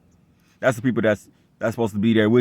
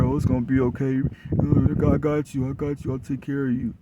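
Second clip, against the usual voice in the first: higher, fading, mock-tender comforting; mock soothing ex; 5 semitones higher than usual. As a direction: parody the ex cooing to her, higher and fading away. Mocking the ex comforting her.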